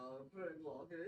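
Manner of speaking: quiet mumbling stoner; stoned guy. Retold or imitated. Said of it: "I, uh".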